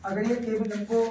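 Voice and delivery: ordinary delivery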